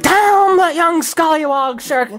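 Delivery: put-on high ranting Scottish old duck; Scottish accent. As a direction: rant in a Scottish brogue, high-pitched. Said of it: "Scrooge ranted in his Scottish accent".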